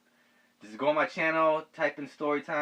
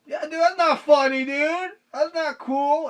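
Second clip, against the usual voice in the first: higher, somewhat louder imitated reproach; lightly hammed disapproval; mock scolding; 12 semitones higher, 5 dB louder, slower than usual. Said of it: "I'm like".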